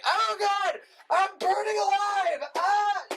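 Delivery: hammed-up high, drawn-out yell; Nicolas Cage. Imitating the man burning in the wicker man, yelling. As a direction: yell it in exaggerated agony. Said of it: "you hear him yelling and saying".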